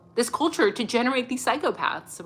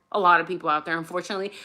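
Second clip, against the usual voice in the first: normal talking voice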